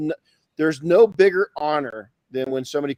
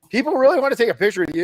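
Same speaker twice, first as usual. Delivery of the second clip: high, louder, fast disbelieving question; incredulous buddies; 9 semitones higher, 5 dB louder, faster than usual. Retold or imitated. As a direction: imitate friends who can't believe it.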